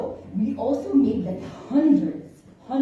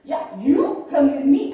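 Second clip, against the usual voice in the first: louder, firm order; stern official; 4 semitones higher, 9 dB louder than usual. Retold or imitated. Airport officer ordering her to follow, firm and louder. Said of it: "She says".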